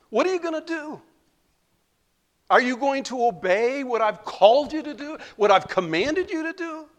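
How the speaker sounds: high, loud, pressing question; rising preacher's challenge. Real, not acted. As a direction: demand an answer from the pews.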